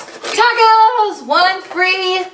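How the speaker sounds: high, delighted exclamation; excited. Real, not acted. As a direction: announce it with glee. Delighted to find tacos.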